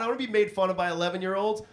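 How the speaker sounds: usual speaking voice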